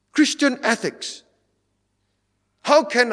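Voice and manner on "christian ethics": incredulous repeat. Shown as a demonstration. Preacher mocking the liar teaching ethics, incredulous. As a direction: repeat it as if it's absurd.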